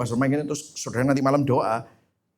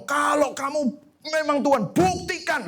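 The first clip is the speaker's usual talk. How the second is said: doa menuntut ditiru; 11 semitones higher than usual. Retold or imitated. Meniru doa yang menuntut bukti.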